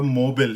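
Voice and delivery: मोबाइल reduced to "mo-bul"; American mobile. Shown as a demonstration. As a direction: clip मोबाइल short: "mobul". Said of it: "अब कितना ज़्यादा फ़र्क है".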